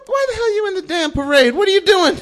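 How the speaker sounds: high, loud police challenge; mock-furious, fast-rising holler; outraged officer. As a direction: demand an explanation in high-pitched outrage. Police officer confronts the gatecrashers.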